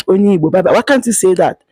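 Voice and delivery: ordinary talk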